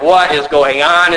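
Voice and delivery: mildly exasperated, WHAT stressed; acted exasperation. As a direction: act out elders who are now openly baffled. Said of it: "at this point, they're like".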